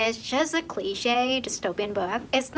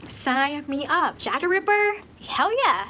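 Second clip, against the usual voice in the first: retold excitement, higher pitch; excited; 5 semitones higher than usual. Reader reacting to a true crime premise, excited. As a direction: eagerly act out being sold.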